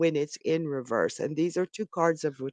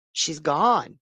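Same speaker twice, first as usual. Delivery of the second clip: ordinary delivery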